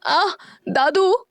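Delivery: high, slowed cry of pain; a sharp hurt yelp, stretched out; girl crying out in pain as glass is pulled. A narrator's reading. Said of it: "Janvi दर्द से चीखी".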